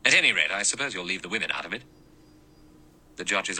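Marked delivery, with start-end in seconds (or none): 0.0-1.8 fast. Acted. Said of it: "Lombard said".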